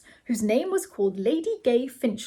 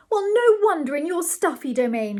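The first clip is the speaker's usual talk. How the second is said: high, louder retort; crying out; 8 semitones higher, 5 dB louder than usual. A narrator's reading. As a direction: cry out indignantly in a raised pitch. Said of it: "cried Ethel".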